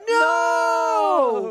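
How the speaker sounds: high loud cry of no; shocked shout. Real, not acted. Commentator shouting as the rider crashes, loud. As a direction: yell out as he crashes.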